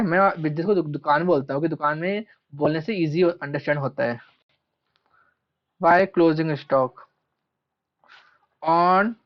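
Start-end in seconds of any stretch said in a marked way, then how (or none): 5.8-9.1 drawn-out words with long gaps; slow dictation. Real, not acted. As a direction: pace it for someone writing along. Dictates while writing it out.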